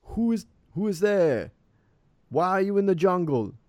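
high, loud call from the wall; shouted challenge. Acted. Villager on the wall questioning jungle travellers, calling down.

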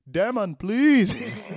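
put-on slow, high pleading in an African accent. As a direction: drag out the plea in an African accent. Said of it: "Good accent".

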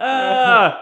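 loud angry yell of outrage; enraged yell. Retold or imitated. Johnny smashing things in the house, furious yell. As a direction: bellow it in a rage. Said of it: "he goes".

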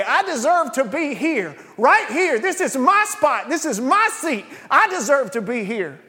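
high, loud, anguished cry; impassioned own voice. Real, not acted. Preacher claiming the criminal's words as his own, loud and anguished. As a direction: cry it out as your own confession. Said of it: "I heard it in my own voice".

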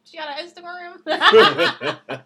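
laughing. Real, not acted. Friend laughing at the therapist.

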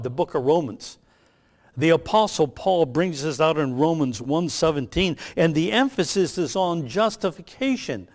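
usual speaking voice

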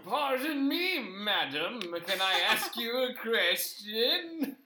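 put-on high, slow posh old gent; posh British mole. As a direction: play a frail, posh mole puppet. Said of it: "a posh British voice filtered through the dirt".

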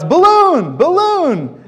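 put-on high, loud toddler cry of balloon; high, loud kid's shout; excited child shout. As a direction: shout it like a thrilled little boy. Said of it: "he always shouts".

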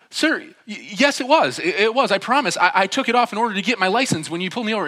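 loud, high, stammering protest; struck with fear. Retold or imitated. Driver insisting to officer about seatbelt, panicked. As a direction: imitate a panicked driver pleading his innocence. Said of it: "so struck with fear".

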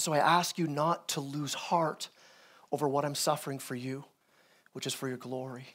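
tender appeal. Acted. Paul asking his readers not to lose heart, tender. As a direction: read it gently, voice dropping away to very soft.

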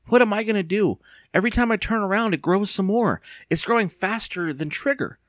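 high-pitched, panicky outburst; crying out. A narrator's reading. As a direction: spill out the rising panic. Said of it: "Evan cried".

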